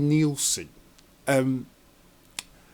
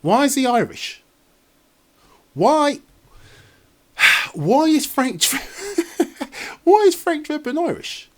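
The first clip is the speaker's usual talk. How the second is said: baffled disbelief; 10 semitones higher than usual. Real, not acted. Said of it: "I don't understand why he's got an Irish accent".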